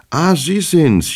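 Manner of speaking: high, drawn-out exclamation of recognition; eager greeting. A narrator's reading. Hero spots his guest of yesterday, eagerly greeting. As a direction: greet him loudly with a long, high Ah.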